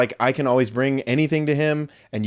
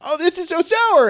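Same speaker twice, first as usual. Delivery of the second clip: high squeaky mocking voice, far above his own; mock whiny friend. Teasing his friend about Warheads in a high squeal. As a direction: squeal it like a kid who can't take sour candy. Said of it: "you would always be like".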